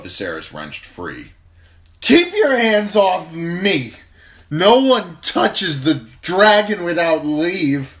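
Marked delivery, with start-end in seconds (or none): 2.0-8.0 much higher, much louder, slow furious yell; angry drunken shout. A narrator's reading. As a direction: throw the knight off in a rage. Drunk prince shoves knight away.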